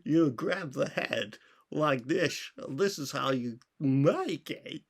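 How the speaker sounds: slow, hammed-up Danish Walken accent, pitched high. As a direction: stretch each word in a swooping mock accent. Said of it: "Christopher Walken, probably".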